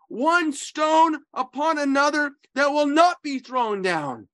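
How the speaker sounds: high, forceful preaching of Jesus's words; lifted, ringing Gospel reading; preaching scripture. Acted. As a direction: proclaim it like a prophet in the temple.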